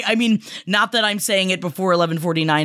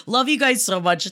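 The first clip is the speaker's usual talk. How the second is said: ordinary talk, nothing marked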